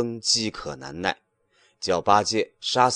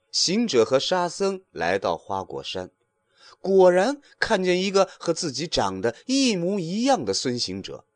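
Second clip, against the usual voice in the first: ordinary delivery, nothing marked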